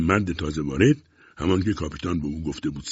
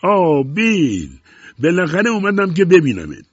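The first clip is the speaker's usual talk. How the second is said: shouting back; 9 semitones higher, 8 dB louder than usual. A narrator's reading. Newcomer greets Bill at last, shouting. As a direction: cry it out, stretching the name. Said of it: "او هم فریاد کشید".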